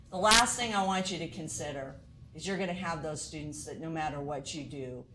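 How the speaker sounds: ordinary talk, nothing marked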